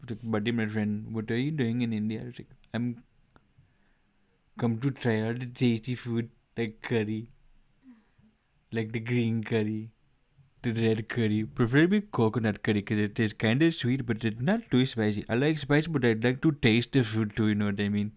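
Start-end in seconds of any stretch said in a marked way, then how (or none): 0.2-2.2 chummy put-on Indian accent; caricatured Indian accent. Shown as a demonstration. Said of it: "base my Indian accent off of the lemur". 2.7-18.1 loud, showy Indian accent; projected put-on Indian accent. Shown as a demonstration. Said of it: "base my Indian accent off of the lemur".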